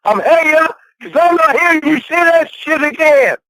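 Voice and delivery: screaming. Real, not acted. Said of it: "You were the one that was screaming".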